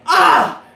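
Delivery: high, loud burst of a yell; short loud playful scream; sudden loud scream. Real, not acted. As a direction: let out a sudden shriek. Sudden outburst.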